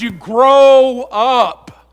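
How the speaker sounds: drawn out very slowly, loud and falling; slow punchline. Acted. Preacher landing the point to the church, slow and weighty. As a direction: land it slow and heavy.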